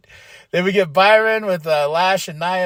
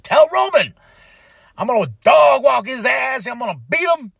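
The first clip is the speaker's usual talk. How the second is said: higher, louder mock wrestler threat; imitating a threat; 8 semitones higher, 5 dB louder than usual. Fatu threatening Roman through the Usos, loud and mock menacing. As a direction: bark it like a promo. Retells Fatu's threat on the mic.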